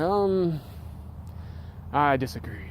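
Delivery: soft, hushed hesitant objection; mock contrarian. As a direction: imitate a hesitant objector, trailing into near-whisper. Acts out an obvious-denying objector.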